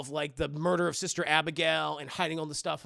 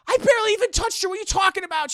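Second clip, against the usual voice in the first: much higher, loud and fast; shrill denial; 13 semitones higher, 9 dB louder, faster than usual. Retold or imitated. Wife denying to husband she hurt the girl, high and loud. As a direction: blurt out the defence in a panic.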